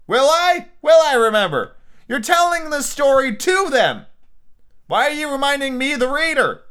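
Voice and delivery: high, loud exasperation; hammed-up incredulous yelling; indignant rant. Reader scolding author for reminding him, loudly indignant.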